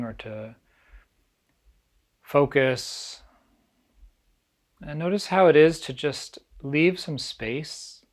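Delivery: calm, unhurried meditation guide with long pauses; guided meditation. Acted. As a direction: ease listeners gently into stillness, leaving space between phrases.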